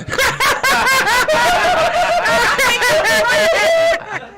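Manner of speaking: high, loud shrieking laugh; howling laugh. Real, not acted. Comic howls laughing at her own bombed set. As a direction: cackle out loud at the memory.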